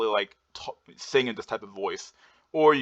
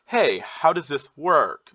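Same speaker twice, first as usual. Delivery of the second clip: high, drawn-out question on "how does this work"; slow, pitched-up asking tune on "Hey, how does this work"; upward inflection; 5 semitones higher, 4 dB louder, much slower than usual. Shown as a demonstration. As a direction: lift WORK as a needy question. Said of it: "you have this upward inflection".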